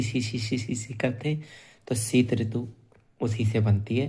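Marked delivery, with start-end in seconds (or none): 0.0-1.4 soft hissing सी सी like shivering from cold; a soft shivery सी सी sound; shivering sss. Shown as a demonstration. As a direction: hiss the सी quietly, teeth together in the cold. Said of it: "सर्दी में सी".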